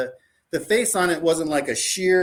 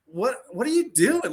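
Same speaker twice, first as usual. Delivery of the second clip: high-pitched, disbelieving question; retold bafflement; 8 semitones higher than usual. Seller recalling seeing the buyer's full Excursion, baffled. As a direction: act out being baffled by what you see.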